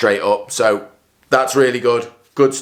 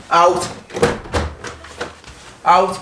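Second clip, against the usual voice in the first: shooing; 5 semitones higher than usual. Real, not acted. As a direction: chase the wasp off. Shooing a wasp away.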